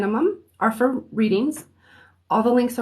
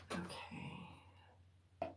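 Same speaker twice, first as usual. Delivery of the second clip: whispering to herself while setting up; 26 dB quieter than usual. Real, not acted. Setting up the stream before going live, whispering to herself. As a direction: murmur under her breath, barely voiced.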